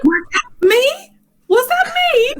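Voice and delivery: high, slow, mock-innocent voice; high innocent squeak. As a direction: play dumb in a very high voice. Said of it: "he's just like".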